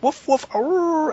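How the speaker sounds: high, drawn-out mock barking and howl; pretend dog noises. Player mimicking furries pretending to be animals downstairs, mock dog howl. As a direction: imitate people playing at being animals. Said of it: "pretending they were the animals".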